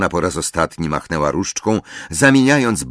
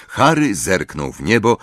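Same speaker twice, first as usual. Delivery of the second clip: usual speaking voice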